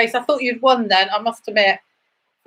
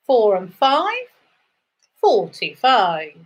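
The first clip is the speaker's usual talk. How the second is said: sing-song number calling, rising then falling; bingo caller; slower than usual. Acted. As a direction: call each number out to the hall.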